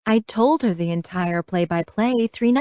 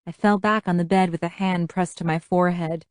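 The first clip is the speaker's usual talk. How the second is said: ordinary delivery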